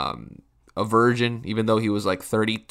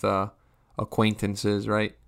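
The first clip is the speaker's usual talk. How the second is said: ordinary delivery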